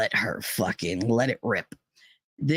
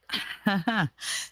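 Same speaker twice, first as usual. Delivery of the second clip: brief laugh higher than speech; short laugh; 5 semitones higher, 4 dB quieter than usual. Real, not acted. Speaker admitting how she deals with trauma, short laugh. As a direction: let out a small soft chuckle.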